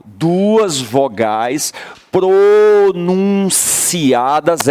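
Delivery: "Duas vogais pronunciadas" drawn out very slowly; key-word emphasis. Shown as a demonstration. Teacher dictating the definition of hiatus, slow emphasis. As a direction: stretch each syllable, above all in "pronunciadas". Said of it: "essa é a palavra-chave".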